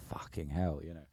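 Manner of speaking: quiet horrified curse; hushed shock. Retold or imitated. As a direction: breathe it out in horror. Shocked at blood.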